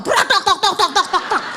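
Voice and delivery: a high clattering crash, then knocking; clattering muffler. Retold or imitated. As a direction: mimic the falling muffler. Muffler falls off mid-ramp. (bunyi knalpot jatuh berkelotak)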